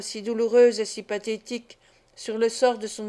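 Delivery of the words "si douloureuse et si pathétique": ordinary delivery, nothing marked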